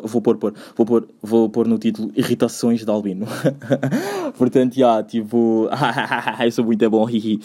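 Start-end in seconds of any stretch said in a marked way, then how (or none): 3.3-4.3 short high laugh at his own joke; high giggle. Real, not acted. Laughing at his own podcast title joke, high giggle.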